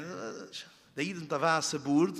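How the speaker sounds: normal talking voice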